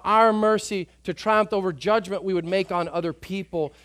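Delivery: proclaimed verse. Acted. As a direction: proclaim it in triumph. Verse repeated, then proclaimed.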